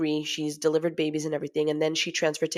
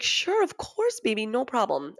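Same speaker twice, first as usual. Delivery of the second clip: breezy agreement; 5 semitones higher than usual. Retold or imitated. Wife agreeing to husband's request, breezy and accommodating. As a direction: agree far too easily, pitched way up.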